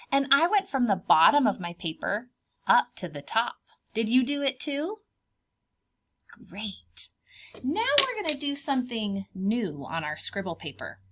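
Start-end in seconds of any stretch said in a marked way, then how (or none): none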